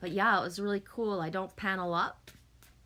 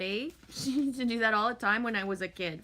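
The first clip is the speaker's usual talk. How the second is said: usual speaking voice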